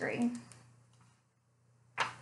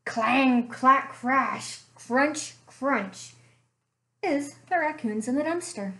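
softer, storytelling read-aloud voice; story narration; 4 semitones lower, 3 dB quieter than usual. A narrator's reading. Librarian reading a bear story to children, gentle storytelling. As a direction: draw the kids into a cozy mystery tale.

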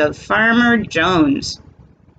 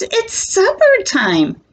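higher cheerful announcement; farmer calling; 12 semitones higher than usual. Acted. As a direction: call the animals to supper. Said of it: "says Farmer Jones".

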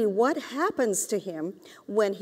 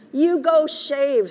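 higher, louder, slow command; bossy mother; 5 semitones higher, 6 dB louder, slower than usual. A narrator's reading. Mother commanding her son to shave, high and loud. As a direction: order him to go shave. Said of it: "said Mother".

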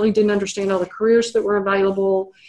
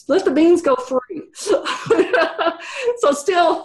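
higher voice breaking into a laugh; amused laughing; 8 semitones higher than usual. Real, not acted. Speaker amused by a student's bean question, laughing. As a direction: say it through real laughter.

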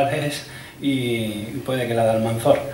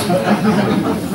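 a quick amused laugh, pitched up; short real laugh; 8 semitones higher than usual. Real, not acted. Lecturer laughing after refusing to negotiate the hyphen, amused laugh. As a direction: laugh at your own joke.

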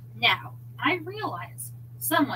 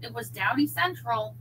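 usual speaking voice

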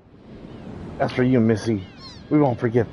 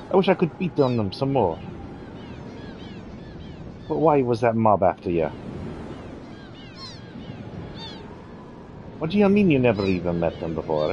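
cheeky Australian girl, lightly voiced; a hint of a brash Aussie tomboy; Australian accent; 5 semitones higher than usual. Kid introducing herself to Serge, light Australian accent.

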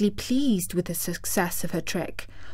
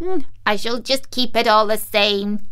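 mocking witch voice; gleefully defiant witch; gloating witch; 3 dB louder than usual. A narrator's reading. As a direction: taunt her as the wicked witch. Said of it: "laughing at her".

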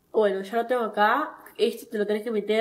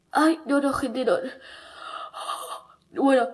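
ordinary talk, nothing marked

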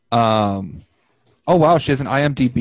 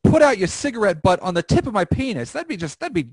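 mock chipper; 10 semitones higher than usual. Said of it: "continues the chipper bit".

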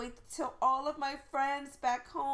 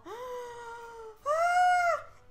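sharp high gasp of delight; excited gasp; 10 semitones higher, 4 dB louder than usual. Real, not acted. First look at the new town, a delighted gasp. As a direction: gasp at the sight.

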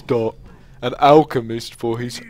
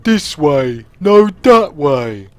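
slow, dull-witted ogre voice; lumbering ogre, slowing on the last words; ogre imitation; 4 dB louder, slower than usual. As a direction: grumble it like the Warcraft two ogre. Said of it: "where he says".

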